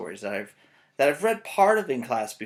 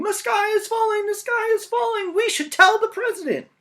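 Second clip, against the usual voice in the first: very high, loud frantic squawk; very high panicked hen; clucking panic; 7 dB louder than usual. Acted. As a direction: shriek like a terrified chicken. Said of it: "running around in circles clucking".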